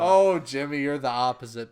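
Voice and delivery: loud mock-soothing outburst; loud, playful reassurance; placating protest. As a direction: gush loudly to appease a friend. Loud placating reply to mock offence.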